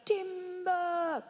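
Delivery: calling out. Acted. Lumberjack calling out again.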